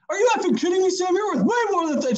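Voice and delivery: high-pitched mock outrage; retold incredulous telling-off; mom reaming him. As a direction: imitate an indignant mom scolding her son, voice way up. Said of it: "she reamed me".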